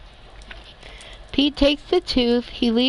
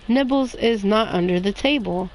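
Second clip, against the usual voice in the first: ordinary delivery, nothing marked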